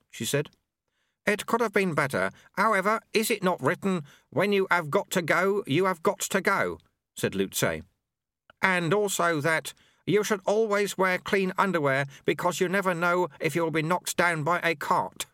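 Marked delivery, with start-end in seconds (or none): 1.3-6.8 put-on higher-pitched old monk voice; Lu-Tze, sagely. Lu-Tze quotes his sayings. 8.6-15.3 put-on higher-pitched old monk voice; Lu-Tze, sagely. Lu-Tze quotes his sayings.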